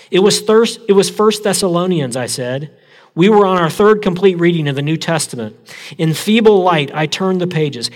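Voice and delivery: lively, heightened reading of a memoir; dramatic story reading. A narrator's reading. Pastor reading a prison camp story to church, heightened and lively. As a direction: read it aloud to the congregation with feeling. Pastor reads book passage aloud.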